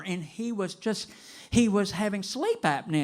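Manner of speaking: normal talking voice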